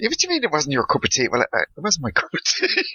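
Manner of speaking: high-pitched, laughing re-enactment; amused retelling. Retold or imitated. Retelling a shop blunder to podcast friends, high and amused. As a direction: act out both sides through rising laughter.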